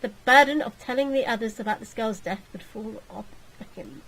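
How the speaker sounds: ordinary talk, nothing marked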